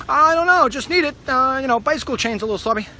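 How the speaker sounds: high, loud youthful pleading; kid asking mom. Retold or imitated. Teenage son asking mom for baby oil, high and loud. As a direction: play his teenage self wheedling. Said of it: "Mother'd be like".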